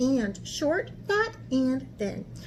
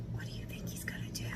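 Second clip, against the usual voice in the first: hushed teasing question; whisper; 14 dB quieter, much faster than usual. Real, not acted. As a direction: lean in and whisper it.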